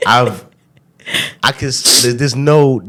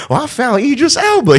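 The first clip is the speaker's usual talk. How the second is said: high, swooning mock girl. Girls swooning over his accent, mock gushing. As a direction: gush like a smitten fan.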